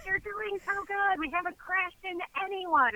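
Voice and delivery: high-pitched, gushing encouragement; imitated cheering. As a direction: squeal praise to keep your partner going. Gal cheering on her partner, retold.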